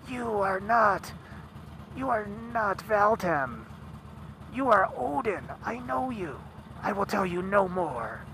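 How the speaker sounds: quietly unmask him as a knowing prophetess; seeress voice. Retold or imitated. The seeress revealing she knows Odin, high and hushed.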